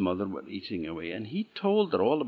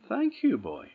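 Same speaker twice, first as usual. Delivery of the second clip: put-on high old woman's thanks; 14 semitones higher than usual.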